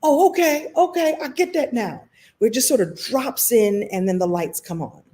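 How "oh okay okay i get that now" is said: high-pitched dawning realization; acted aha moment. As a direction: act out the lights coming on. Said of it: "I call those ahas or oh snaps".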